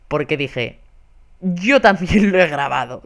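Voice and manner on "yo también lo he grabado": retold amusement. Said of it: "llorando de la risa".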